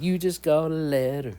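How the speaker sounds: sing-song TV host imitation; Blue's Clues host. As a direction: imitate a children's show host announcing mail. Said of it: "The guy said".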